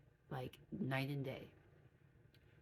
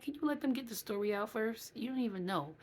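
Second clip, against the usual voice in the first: impatient teasing; 6 semitones higher, 4 dB louder than usual. Retold or imitated. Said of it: "I'm like".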